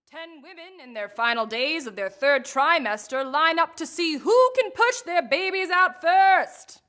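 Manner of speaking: TV news anchor read; newscaster voice. Pretend. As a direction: announce it like a newscaster. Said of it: "read it like that in that newscaster voice".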